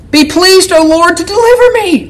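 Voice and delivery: high, fervent plea to the Lord; pleading cry. Shown as a demonstration. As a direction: cry it out like a desperate prayer. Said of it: "I'm crying out again".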